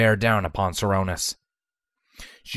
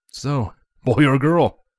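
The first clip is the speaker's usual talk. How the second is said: raised, louder eager question; enthusiastic; 5 semitones higher, 5 dB louder, slower than usual. A narrator's reading. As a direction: ask it eagerly, louder and slower. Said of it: "Broadsword asked enthusiastically".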